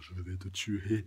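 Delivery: low, slow menacing voice; a light hint of a threatening killer; lightly voiced deadly threat. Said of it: "il a vraiment un regard".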